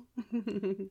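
breathy mocking giggle; little sneering laugh; giggly evil cackle. Taunting laugh at victim.

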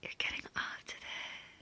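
soft, knowing whisper; whispered confirmation. Acted. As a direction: drop to a hushed whisper. Same words as whispered confirmation.

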